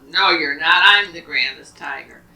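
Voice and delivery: arguing tiger. Acted. Second tiger argues back at the first, loud and boastful. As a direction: cut him off and claim the title. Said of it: "they're arguing".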